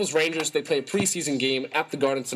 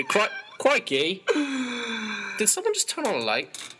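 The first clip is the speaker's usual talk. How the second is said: put-on a clowning Australian accent, pitched up; 9 semitones higher than usual. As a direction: play a startled Aussie bloke, crikey and all. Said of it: "I won't do it anymore".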